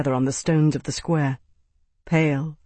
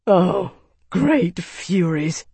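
dismayed; 3 semitones higher, much slower than usual. Acted. Tavi reading grim orders.

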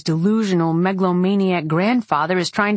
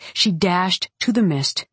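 usual speaking voice

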